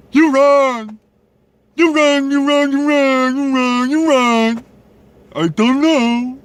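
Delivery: high, loud hammed-up chanting; mock crazy person. Vlogger mocking people who don't understand reason, high loud crazed voice. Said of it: "They just understand".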